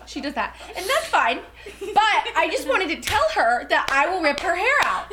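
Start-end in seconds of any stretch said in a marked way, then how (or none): 0.8-1.4 amused imitation of her catchphrase, pitched up; laughing mimic. Friend mimicking her catchphrase while laughing. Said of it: "she goes like this". 1.8-5.1 higher, slow, giggling mock threat; laughing mock threat. Mimicking friend's drunk threat, laughing, pitched up. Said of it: "she goes like this".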